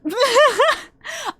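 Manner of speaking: high, loud burst of laughter. Real, not acted. Laughs at being under one hour.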